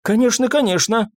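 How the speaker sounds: put-on high, flustered old pharmacist; lightly voiced doddering assent; babbling old man. A narrator's reading. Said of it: "залепетал доктор".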